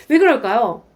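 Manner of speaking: ordinary talk, nothing marked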